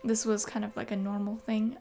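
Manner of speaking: ordinary delivery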